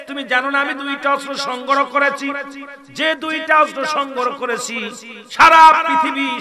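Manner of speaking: high, loud fiery sermon voice; preaching at full force. Acted. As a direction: preach it out with fire. Preacher in full force telling story.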